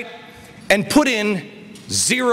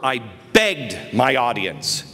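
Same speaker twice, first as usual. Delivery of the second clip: ordinary talk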